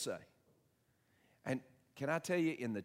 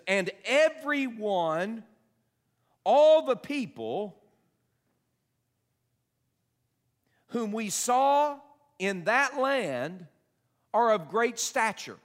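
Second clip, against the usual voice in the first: slow, high, loud awed reading; dramatic scripture reading; 10 semitones higher, 10 dB louder, much slower than usual. Acted. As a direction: draw out the fear of giants.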